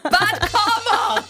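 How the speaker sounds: "Bad comma" slow and high, breaking into laughter; laughing mock accent. Host laughs through a failed British accent. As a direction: give up laughing mid-accent. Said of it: "what accent was that?".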